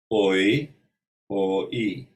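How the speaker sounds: "oi" said firmly, then parted into "o" and "e"; diphthong split in two. Shown as a demonstration. Teacher explaining a diphthong, "oi" broken into its vowels. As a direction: sound out "oi" as two separate vowels. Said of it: "two vowels".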